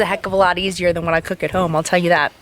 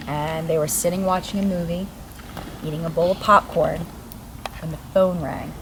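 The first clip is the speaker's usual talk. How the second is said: hushed, slow spooky storytelling; ghost story narration; 3 semitones lower, 5 dB quieter than usual. Acted. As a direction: tell it like a campfire tale. Tells ghost story by fire.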